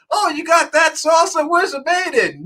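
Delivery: quoting the salsa commercial, shouting high. Retold or imitated. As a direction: holler it like the old commercial. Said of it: "that salsa commercial".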